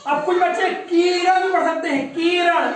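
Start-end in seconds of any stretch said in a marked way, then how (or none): 0.9-1.4 किरण lengthened to "keeran"; long ee misreading. Shown as a demonstration. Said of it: "बड़ी ई की मात्रा हो जाएगी". 2.1-2.7 किरण said as "keeran"; long ee misreading. Shown as a demonstration. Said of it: "उच्चारण जो है वो दीर्घ हो जाएगा".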